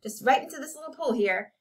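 bully him with an ultimatum; threatening. A narrator's reading. Jeremy threatens to soak the jacket.

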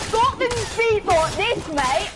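high, loud, slow mock Scottish; exaggerated, drawn-out attempt at a Scottish accent. Shown as a demonstration. Player shows teammates how Scotland speaks, mock Scottish accent. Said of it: "that was Scottish".

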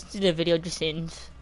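usual speaking voice

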